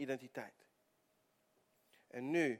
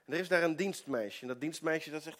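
normal talking voice

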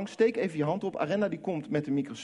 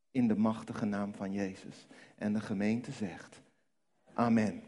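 lower-pitched, solemn prayer voice; congregational prayer; 4 semitones lower than usual. Real, not acted. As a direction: lead the church in prayer, slowing into the closing phrases. Congregational prayer led by the preacher. (plechtig gebed namens de gemeente)

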